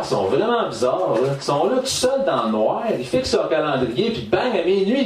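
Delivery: ordinary talk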